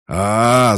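long, loud, high-pitched yell; charging scream. A narrator's reading. He screams as he lunges forward, full-voiced yell. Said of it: "закричал он и рванул вперёд".

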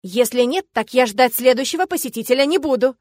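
loud warning called downstairs; shouted threat. Acted. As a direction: keep yelling the threat.

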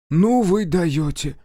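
high, drawn-out amazement; flabbergasted. A narrator's reading. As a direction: marvel at their madness, stretching the words. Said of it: "всплеснул руками Аверкин". (изумлённо, высоко и протяжно)

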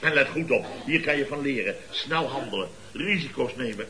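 ordinary talk

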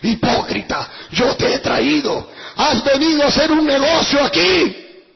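slow, loud preacher thundering; prophetic rebuke. Retold or imitated. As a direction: denounce the hypocrite slowly and loudly. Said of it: "comenzó a hablar en lengua".

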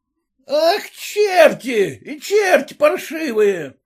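high, drawn-out peasant curse; exasperated high-pitched yell; angry shout. A narrator's reading. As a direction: cry out curses at them. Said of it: "опять крикнул краснорожий мужик".

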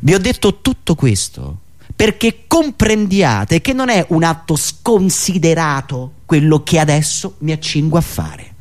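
usual speaking voice

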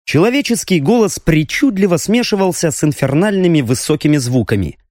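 ordinary delivery, nothing marked

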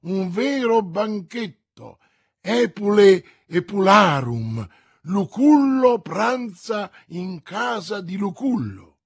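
hammed-up miser exulting. A narrator's reading. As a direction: puff up like a host boasting of a banquet. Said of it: "esclamò Coquenard".

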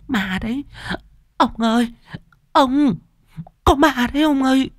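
very high, frightened wailing; panicked cries. A narrator's reading. Mistress pointing out a ghost to husband, panicked. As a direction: cry out in helpless panic. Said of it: "cô luống cuống chỉ tay".

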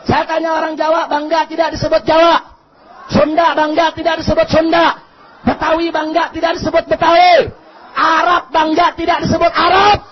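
loud rally call-and-response; full-force oratory. Real, not acted. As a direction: shout each question out to rouse the crowd. (orasi lantang membakar semangat)

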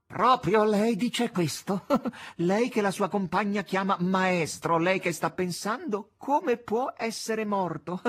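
high, loud, slow mock astonishment; mocking incredulity. A narrator's reading. Azazello chiding the maestro, mock disbelief. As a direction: draw it out in incredulous scorn.

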